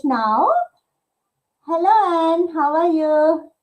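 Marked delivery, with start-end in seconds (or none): none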